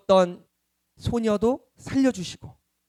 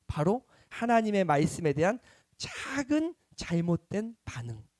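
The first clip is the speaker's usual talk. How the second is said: ordinary talk, nothing marked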